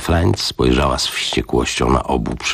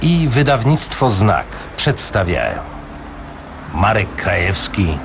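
formal radio announcer intro; announcer read; 3 semitones higher than usual. Acted. Radio announcer introducing an audiobook, formal.

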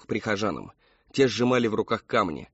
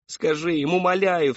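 loud, high-pitched begging; pleading cry; 7 semitones higher, 5 dB louder than usual. A narrator's reading. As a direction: cry out imploringly to your daughter. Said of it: "вскричала мать Мэри Кэтрин".

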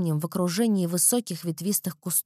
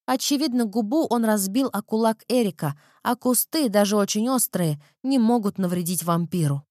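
ordinary delivery

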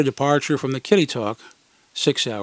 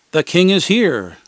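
slow, louder mock proclamation; announcing; 4 semitones higher, 6 dB louder than usual. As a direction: declare it to the static. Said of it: "I announced".

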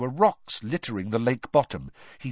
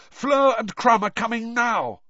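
panicky voice; 10 semitones higher, 5 dB louder than usual. Acted. Cedric reports, panicked.

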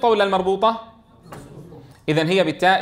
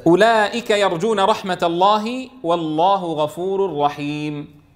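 Quran recitation; 3 semitones lower than usual. Shown as a demonstration.

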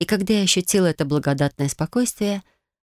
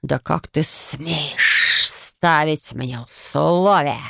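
growling hiss; much slower than usual. A narrator's reading. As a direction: hiss it out like an angry snake-man. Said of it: "прорычал, прошипел мужчина".

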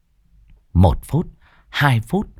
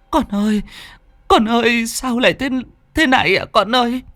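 wailing lament; 13 semitones higher than usual. A narrator's reading. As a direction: wail over the dead child like a mother. Grandmother wailing over dead son. (mẹ khóc than thảm thiết, giọng cao, kéo dài)

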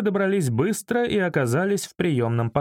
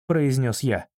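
ordinary delivery, nothing marked

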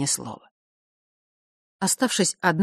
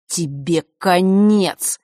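slow, louder threat; menacing through teeth; 6 dB louder, much slower than usual. A narrator's reading. Брат угрожает сестре, медленно и зловеще. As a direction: drag out the threat. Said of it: "процедил он".

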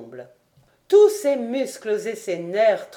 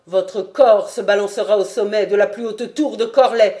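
furious threat; 8 dB louder than usual. A narrator's reading. Lord threatens to hang lieutenant.

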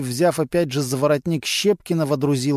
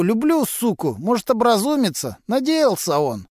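higher, rough street voice of a petty crook; a sly garage lowlife gossiping to cops; Vasily, a gruff mechanic; 8 semitones higher, 3 dB louder than usual. A narrator's reading. Suspect gossiping to detectives, in a raised streetwise voice.

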